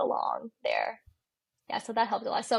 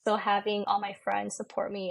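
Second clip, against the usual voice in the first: ordinary delivery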